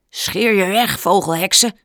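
loud angry shout up at the sky. Acted. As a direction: shout them away. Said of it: "schreeuwde hij".

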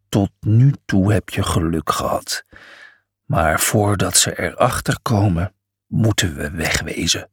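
low lion voice, urgent; gruff lion. A narrator's reading. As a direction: warn them in a low growl. Lion Miles speaks.

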